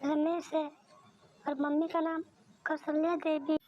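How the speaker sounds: put-on high little boy asking for food; high, small boy's voice; child voice. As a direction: sound like a small hungry child. Narrator voices child Bhola.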